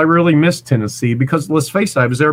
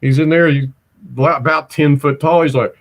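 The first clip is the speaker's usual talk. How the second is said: ordinary talk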